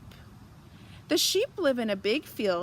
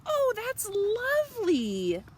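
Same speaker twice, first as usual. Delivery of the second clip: very high, thrilled thanks; delighted farmer; 12 semitones higher than usual. A narrator's reading. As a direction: gush with delight. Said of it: "says Missus Boot".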